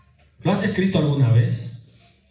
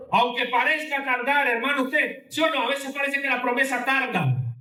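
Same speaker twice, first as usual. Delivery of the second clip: pleading preach; 8 semitones higher than usual. Real, not acted.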